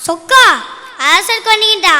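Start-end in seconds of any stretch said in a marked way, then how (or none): 0.1-0.4 loud rising cry higher in pitch; calling out to god. Acted. Poet crying out to Lord Chokka for help, loud. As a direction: shout the god's name skyward.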